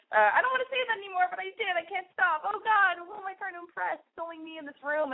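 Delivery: high, fast, self-conscious babble; flustered rambling. Real, not acted. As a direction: rush it out, squirming at yourself. Flustered after saying puss.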